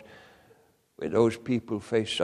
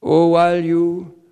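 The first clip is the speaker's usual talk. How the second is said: loud, higher urgent exhortation; full-force preaching; 7 semitones higher, 8 dB louder than usual. Acted. Preacher urging brothers and sisters, loud and high. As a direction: press it on the congregation, pleading loudly.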